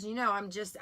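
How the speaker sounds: ordinary delivery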